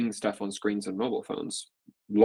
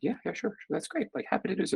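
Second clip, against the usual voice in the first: much higher, softer, over-eager; eager yes; 10 semitones higher, 3 dB quieter than usual. Retold or imitated. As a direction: gush a keen agreement. Eagerly accepting the call.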